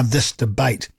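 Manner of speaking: ordinary delivery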